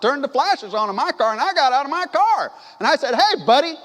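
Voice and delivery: loud, high, angry "Hey, buddy" call; hammed-up tough guy calling out the driver; pretending to confront the delivery driver, mock shout; mock confrontational shout. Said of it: "I didn't say it like that".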